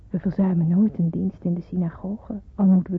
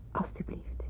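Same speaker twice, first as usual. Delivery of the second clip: much softer, almost unvoiced; shy whispered offering; 11 dB quieter than usual. Giving her handmade presents to her parents, a soft whisper. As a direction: hand over the gift with a breath.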